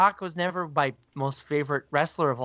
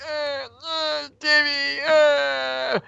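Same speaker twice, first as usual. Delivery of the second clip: very high, drawn-out squealing whine; hammed-up tongue-tied fan squeal; starstruck fanboy mess; 11 semitones higher, much slower than usual. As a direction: play a fan falling apart before an idol. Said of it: "would have just been, like, a mess".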